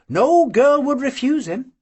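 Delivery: plain reading voice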